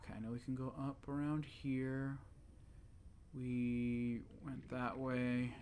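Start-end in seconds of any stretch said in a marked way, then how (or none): none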